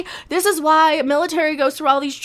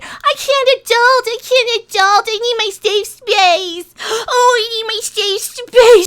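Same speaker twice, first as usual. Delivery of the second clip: higher, slow whiny mock; 6 semitones higher, slower than usual. Reviewer mocks people who say they can't adult, high and whiny. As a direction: whine it like a helpless complainer. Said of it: "people who go around saying".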